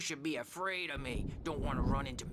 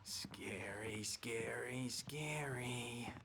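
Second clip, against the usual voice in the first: low, hushed, drawn-out spooky chant; spooky whisper; 5 semitones lower, 5 dB quieter, much slower than usual. Acted. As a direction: whisper it slowly to creep someone out. Emerson trying to frighten Wake.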